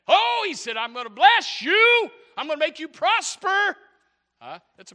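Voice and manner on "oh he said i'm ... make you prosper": very loud, high, drawn-out shout; exultant preacher at full volume; full-force preaching. Real, not acted. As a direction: shout God's promise to the whole church.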